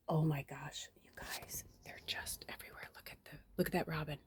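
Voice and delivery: soft, awed, unvoiced whisper; real whisper. Real, not acted. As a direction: hush so the birds stay. Whispering at birds outside.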